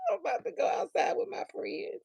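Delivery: fading laugh-whisper. Real, not acted. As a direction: whisper it through leftover laughter. Repeats quote whispering, laughing.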